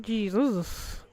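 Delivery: ordinary talk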